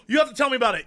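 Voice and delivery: fast, excited plea; breathless eager request; eager gasp. Real, not acted. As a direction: gasp and rush the words out.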